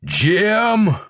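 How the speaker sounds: a mild read-aloud yell. A narrator's reading. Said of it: "George shouted".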